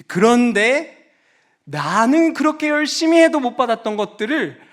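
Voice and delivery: high, loud resentful complaint; aggrieved outburst. Acted. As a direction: cry out at the injustice. Voicing son's grievance.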